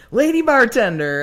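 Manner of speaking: big, loud, high beaming hello; delighted greeting. Retold or imitated. The singer recognizes her and beams out the nickname, loud and high. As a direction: call it out with a big grin. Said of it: "he smiled really big and goes".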